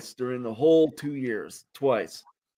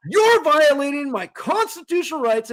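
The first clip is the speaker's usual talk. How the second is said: higher and louder, declaring it to the crowd; retold yell; 11 semitones higher, 4 dB louder than usual. Retelling how he accused the manager before shoppers, a high loud mock yell. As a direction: proclaim it like a rally cry. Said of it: "I'm yelling at this guy".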